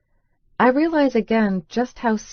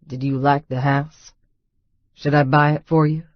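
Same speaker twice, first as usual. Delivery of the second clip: low male voice; 4 semitones lower, 3 dB louder than usual. A narrator's reading. Boone voiced by narrator.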